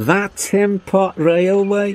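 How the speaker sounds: high, slow, incredulous question; high-pitched scoffing character voice; scornful interruption. A narrator's reading. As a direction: draw it out high with disdain. Engine mocks Duck's Great Western railway.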